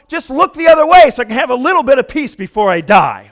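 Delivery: loud, fast, high exasperated rush; worked-up pleading complaint; fed-up outburst. Acted. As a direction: rattle it off loudly, out of patience. Paraphrases psalmist fed up.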